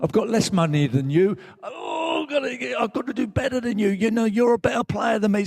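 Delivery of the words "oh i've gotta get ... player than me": high, anxious inner voice; frantic self-comparison. Acted. Player measuring himself against a rival, frantic and high. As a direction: act out a driven, envious inner nag, pitched way up. Acting out his competitive inner voice.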